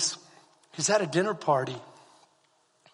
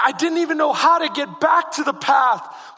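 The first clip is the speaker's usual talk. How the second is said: ordinary talk, nothing marked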